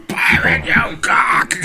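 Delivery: huge high gasp, then strangled words; gasping panic. Retold or imitated. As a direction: imitate a terrified gasp at a sheer drop. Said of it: "ran right to the edge and went".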